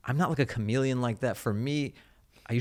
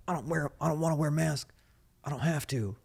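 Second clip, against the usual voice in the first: higher, put-on whiny voice of a stubborn guy; mock anti-masker voice; 6 semitones higher than usual. Mocking an anti-mask guy for the co-host, higher put-on voice. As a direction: sneer out his refusal in a higher voice. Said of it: "That guy could have a regular voice too".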